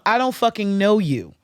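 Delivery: loud, defiant brush-off; angry retort. Retold or imitated. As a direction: tell them off hard. Telling off debt collectors.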